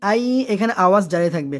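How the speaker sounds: usual speaking voice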